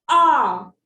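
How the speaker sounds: long, drawn-out "ah" as an aw; aw sound demo. Shown as a demonstration. Teacher introducing a new vowel sound, "ah" held as aw. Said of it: "we have another sound which is".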